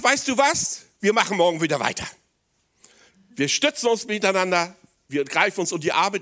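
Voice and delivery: high, loud, eager encouragement; rally each other on; enthusiastic disciples. Acted.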